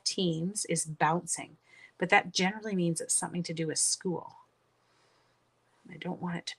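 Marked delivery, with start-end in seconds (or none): none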